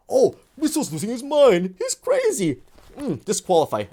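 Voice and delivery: high mocking jeer. Mocking critics who call him crazy, high and squeaky. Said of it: "mocking critics".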